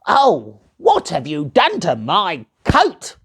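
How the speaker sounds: a touch of a whiny gnome; fussy little man complaining; grumpy gnome. Acted. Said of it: "said the gnome".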